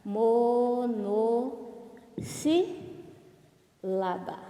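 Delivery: MONOSSÍLABA drawn out as mo-no-sí-la-ba, very slowly; syllable by syllable. Shown as a demonstration. Teacher shows pupils the term, "Monossílaba" syllable by syllable. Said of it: "Olha lá".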